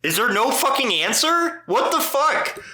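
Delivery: high exasperated disbelief; a real high-pitched what-the-fuck at silence; exasperated outburst. Real, not acted. Host baffled when his friend won't answer, exasperated.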